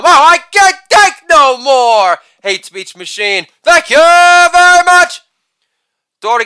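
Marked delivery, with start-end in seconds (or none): 0.0-2.2 high, loud mock plea; over-the-top cry of surrender; hammed-up wail. Hammed-up outburst closing the bit. 3.6-5.2 high, loud, sustained catchphrase; showman's ringing thank-you; showy sign-off. Acted.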